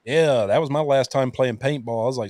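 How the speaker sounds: ordinary talk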